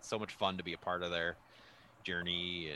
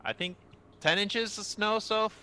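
calling to another room; 13 semitones higher, 7 dB louder than usual. Real, not acted. Checks with partner in another room.

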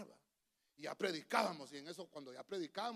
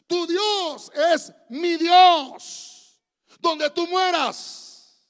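high, very slow full-force shout; Ruth; 11 semitones higher, 22 dB louder, much slower than usual. Acted. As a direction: stretch out each word in a roaring vow. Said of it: "Pero Ruth contestó". (grito solemne, muy lento y potente)

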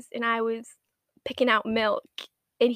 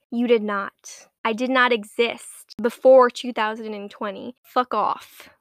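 louder, defiant denial of the past; loud defiant rant; 8 dB louder than usual. Real, not acted. Vlogger disowning her old pictures, loud and defiant.

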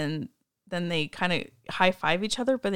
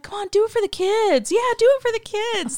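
coaxing coach; 5 dB louder than usual. Retold or imitated. Coach coaxing catcher voiced.

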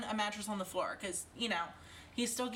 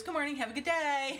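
put-on toddler voice; 6 semitones higher, 4 dB louder than usual. Mother imitates her toddler son.

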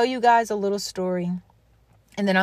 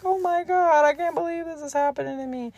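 imagined crying; 7 semitones higher than usual. Retold or imitated. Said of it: "I would have been crying".

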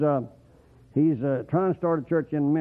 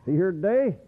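usual speaking voice

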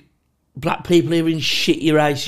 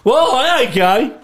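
loud, high defensive yell; mock indignant shout; 9 semitones higher, 6 dB louder, slower than usual. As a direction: shout it like a panicky lad. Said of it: "just shouting".

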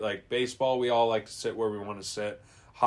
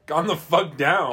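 mock firm command; 4 semitones higher, 5 dB louder, slower than usual. Friend voices what to say.

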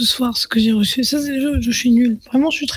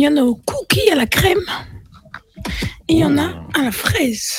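whispering; 4 dB quieter than usual. Real, not acted. Listing flavours, whispered.

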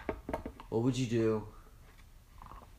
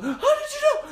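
mock surprise; 6 dB louder than usual. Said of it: "So I was like".

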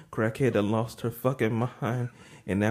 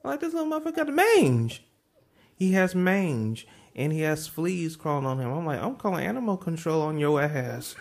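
much higher, fast in places; high comic rant; 12 semitones higher than usual. Retold or imitated. Complaining to the crackhead about her mangy dog, high rant. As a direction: shriek it like it's a catastrophe.

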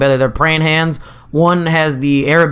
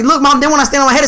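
teasing call; 10 semitones higher, faster than usual. Retold or imitated. Teasing Mom while running away.

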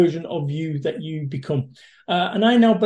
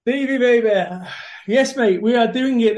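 excited greeting; 5 semitones higher, 3 dB louder than usual. Real, not acted. Guest greets host enthusiastically.

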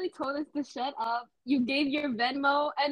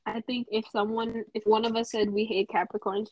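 usual speaking voice

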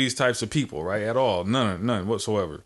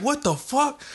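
shocked reaction; slower than usual. Retold or imitated. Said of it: "And I was just like".

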